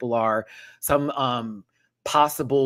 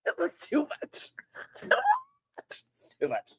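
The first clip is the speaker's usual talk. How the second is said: high squealing laugh; 6 dB quieter than usual. Real, not acted. Laughing after reality clip.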